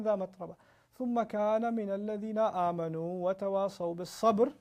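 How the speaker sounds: fast, melodic chanted recitation; Quran recitation. Acted. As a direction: chant the verses in tajweed flow.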